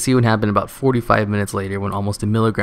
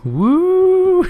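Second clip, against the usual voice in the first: a huge falsetto sitcom whoop, much higher and louder; Family Matters whoop; 6 dB louder than usual. Acted. Sitcom husband cheering during CPR, high falsetto whoop. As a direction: squeal it like a sitcom catchphrase. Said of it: "Family Matters style".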